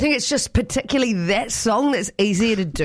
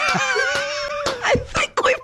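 laughing; much slower than usual. Real, not acted. Laughing praise after attempt.

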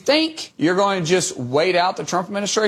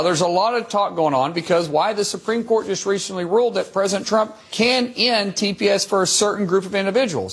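ordinary talk

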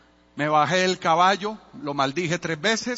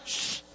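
soft unvoiced hush; the kid hushing the runaway horse; shushing a horse; 9 dB quieter than usual. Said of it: "Peladito de nueve años".

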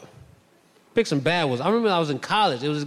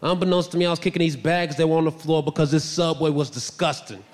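ordinary delivery

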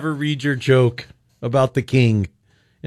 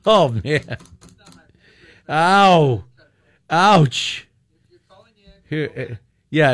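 ordinary talk, nothing marked

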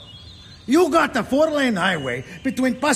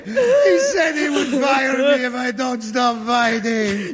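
slow, sobbing and laughing; crying; 3 semitones higher, slower than usual. Acted. Trucker and widow crying together, half laughing. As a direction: weep out each word.

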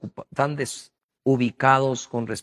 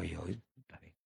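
meek reply; 10 semitones lower, 20 dB quieter than usual. Retold or imitated. Prophet's meek reply to God.

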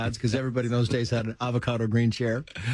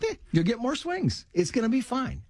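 much higher, casual mock praise from a dad; breezy fatherly pat on the back; offhand dad praise; 10 semitones higher than usual.

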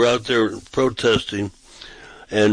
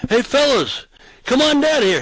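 yelling down the street; 13 semitones higher than usual. Retold or imitated. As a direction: call out down the sidewalk. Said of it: "So I yelled down the sidewalk".

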